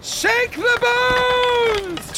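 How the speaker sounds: very high, long-drawn shout; frenzied gambler's cry. Acted. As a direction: bellow the game call, stretching every word. Players shout to the dealer.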